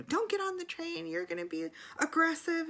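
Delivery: very high-pitched, nagging mimicry; mock an official who keeps scolding; mimicked scolding. Conductor still going at boarding passenger, high mock scolding. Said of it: "she just keeps going".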